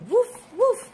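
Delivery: high, playful puppy woofs; yappy little dog voice; puppy barks. Acted. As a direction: bark like a small happy puppy. Storyteller voices the puppy Biscuit.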